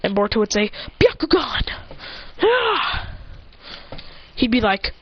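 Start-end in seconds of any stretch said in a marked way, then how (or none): 1.0-1.7 high mock battle cry. Boruto calling out his technique. 2.4-3.1 high, short mock yell; battle yell. Imitated battle cry.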